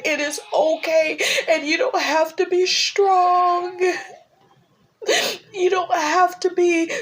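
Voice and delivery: pleading through real tears; tearful. Real, not acted. Speaker reassuring grieving viewers, crying. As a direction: voice climbs high and breaks into crying. Tells viewers grief is okay.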